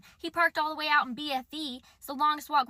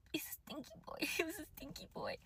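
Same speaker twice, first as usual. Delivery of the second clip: whispered aside; 4 semitones higher, 12 dB quieter than usual. Real, not acted. Telling viewers about her date in a conspiratorial whisper. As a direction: lean in and whisper it like a secret.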